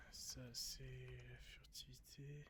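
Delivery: murmure lent et soufflé; chuchoté. Pretend. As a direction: chuchoter lentement, comme en cachette. Passe en mode furtif. (chuchotement lent de joueur furtif)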